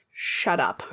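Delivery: low, soft hiss of shut up; hushed shut up. Acted. Clay silences a player, low and hushed. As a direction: shush them sharply and low. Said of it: "smacks a hand over your face and just goes".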